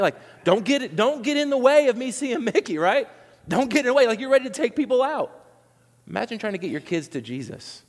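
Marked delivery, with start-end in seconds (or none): 0.0-5.5 higher, pushy line-cutter; mock pushy parent. Preacher mimicking a parent shoving toward Mickey, lightly hammed. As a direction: play a fed-up Disney fan.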